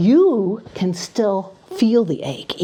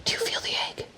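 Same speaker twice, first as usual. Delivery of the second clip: hushed whisper; 3 semitones lower, 9 dB quieter, faster than usual. Acted. Whispered magic.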